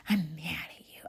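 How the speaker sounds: soft, breathless retold whisper of a sulky kid. Girl whispering to her friend, mock sulky whisper. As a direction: hiss it to your friend under your breath. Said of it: "she whispered to her friend again".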